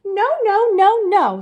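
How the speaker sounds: high, loud repeated no; the girls protest all at once; chorus of protest. A narrator's reading. The sisters protesting loudly to the bear. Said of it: "said Lolly and Molly".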